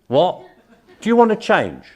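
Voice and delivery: loud, high-pitched demand; blunt challenge. Acted. Expert repeating it down the phone, blunt challenge. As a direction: call the question out insistently.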